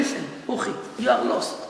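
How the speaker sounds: low, hushed tempter's voice; Yetzer Hara. Acted. Evil inclination telling you that you are lost, low hushed voice. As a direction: drop low and soft, an inner tempter talking. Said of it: "The Yetzer Hara comes to you and tell you".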